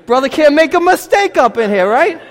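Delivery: loud mock complaint. Real, not acted. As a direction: appeal to the crowd, laughing. Preacher's playful complaint.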